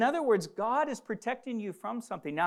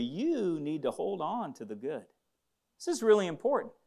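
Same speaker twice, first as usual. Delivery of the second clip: usual speaking voice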